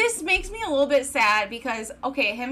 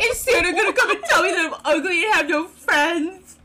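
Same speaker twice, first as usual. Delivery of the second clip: higher, louder mock quote through laughter; mimicking Spencer; 5 semitones higher, 3 dB louder than usual. Host echoing the line that caused the spit take, mocking through laughter. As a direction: repeat the line while cracking up. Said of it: "he goes".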